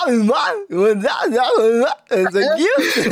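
playful Caribbean accent, imitating the cartoon crab. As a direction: ham up a Caribbean crab greeting a friend. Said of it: "habla como el cangrejo de La Sirenita".

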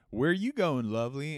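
a sleazy trucker luring a hitchhiker; creepy trucker. Retold or imitated. Trucker leers at a hitchhiker from his cab, high and loud. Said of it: "the guy pulls up and he goes".

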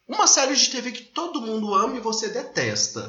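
high, hammed-up question delivery; exaggerated, high-pitched host voice; caricatured interviewer. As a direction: ask it like an over-the-top interviewer. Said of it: "Tô caricata pra caralho".

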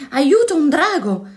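high, loud shout for help; alarmed cry. A narrator's reading. Zeno fleeing from a dragon, alarmed high shout. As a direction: cry out in acted panic. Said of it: "gridò scappando Zeno".

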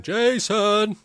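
loud high shouting of a name; calling out Jason. Retold or imitated. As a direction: call Jason across the shopping centre. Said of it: "walking around going".

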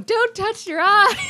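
high, loud, laughing mock shout; mock yell. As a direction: yell it like a panicked warning. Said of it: "You were just yelling at everyone".

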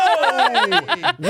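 high, laughing whoa. Real, not acted. Reacting to her friend's boast, a high laughing whoa. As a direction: shriek out a delighted whoa.

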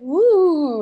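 high, loud drawn-out ooh; awed marvelling. Retold or imitated. Crowd gawping at a panda at the zoo, mock awe. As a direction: coo like a crowd imitating wonder. Onlookers marvel at exotic sight, retold.

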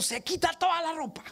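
orden más aguda de maestra severa. Retold or imitated. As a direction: imita a la maestra que manda. Maestra lo lleva al baño. (la maestra en el baño manda al niño, aguda y severa)

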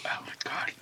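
whispered disgust; whispering. Real, not acted. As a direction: whisper in horror at the taste. Whispered disgust after tasting.

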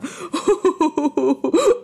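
high hearty laugh; bear belly laugh. Acted. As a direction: guffaw. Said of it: "a las carcajadas".

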